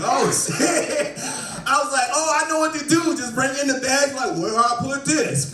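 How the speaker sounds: high, laughing, eager and giddy; giddy excitement. Retold or imitated. Driver thrilled by the customer's invitation, high giddy laughing. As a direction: sound like you can't believe your luck.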